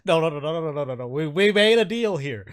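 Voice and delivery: high, loud, flustered pleading voice; eager nervous captive. Acted. Captive pleading with his captor over their deal, high and flustered. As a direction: agree frantically, then protest the deal. Game master plays captive man.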